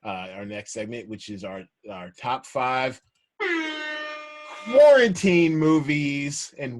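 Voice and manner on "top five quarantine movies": slow, loud, grand announcement; announcer voice. Pretend. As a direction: boom it out like a game show host. Introducing the show's segment.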